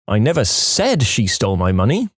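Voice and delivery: "said" punched, questioning rise at the end; stress on SAID. Shown as a demonstration. Testing a phrase's meanings, stress on "said". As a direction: lean hard on the word "said". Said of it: "depending on which word you stress".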